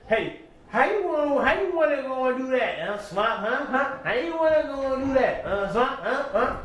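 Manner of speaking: mocking battle taunt. Recapping a battle, taunting [name] mockingly. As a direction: taunt the opponent like the battler did. Imitating rapper taunting opponent.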